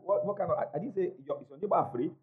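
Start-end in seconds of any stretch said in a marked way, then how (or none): none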